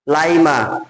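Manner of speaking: ordinary talk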